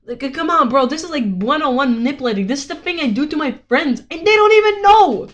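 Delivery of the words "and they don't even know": excited. Real, not acted. Boasting, voice jumps up.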